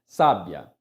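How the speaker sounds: "Sábia" with sá hit loud, low falling end; stress on first syllable. Shown as a demonstration. Teacher closing the example, "Sábia" stressed on sá. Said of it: "proparoxítona".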